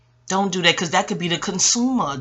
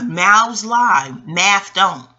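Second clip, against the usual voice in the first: slow emphatic slogan; 4 dB louder, much slower than usual. Real, not acted. Coining her Aquarius slogan.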